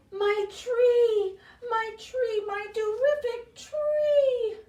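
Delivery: high, loud, thrilled squeal; a delighted cat crying out over his tree, slowing at the end; excited cat voice. Acted. Dewey the cat spotting his tree, high excited squeal.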